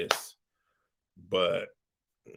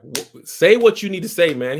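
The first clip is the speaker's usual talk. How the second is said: loud, higher, pushy demand; impatient shout; 8 semitones higher, 9 dB louder than usual. Acted. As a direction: bark at him to spit it out. Impatient demand to spill the news.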